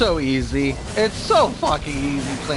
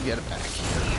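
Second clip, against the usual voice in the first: breathy under-the-breath whisper; hushed command while focusing on the fight; whispered aside; 5 semitones lower, 6 dB quieter than usual. Real, not acted. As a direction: whisper it to yourself. Unvoiced aside while playing.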